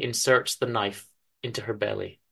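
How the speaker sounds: ordinary delivery, nothing marked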